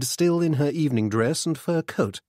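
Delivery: ordinary delivery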